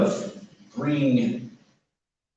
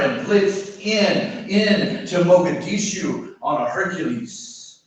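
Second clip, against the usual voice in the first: raised, declaiming spoken-word voice; poetry reading; 8 semitones higher than usual. Acted. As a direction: recite in measured phrases, pitch lifted above everyday talk. Reads next poem aloud.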